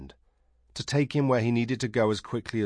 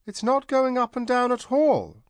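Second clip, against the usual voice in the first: brisk dismissive; 5 dB louder than usual. A narrator's reading. Tanar brisk retort to princess.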